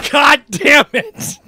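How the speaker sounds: loud, slow, high angry curse; mom swearing. Retold or imitated. Mom cursing at her son over the missing lighter, angry. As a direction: spit it out like a furious mom. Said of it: "she would be like".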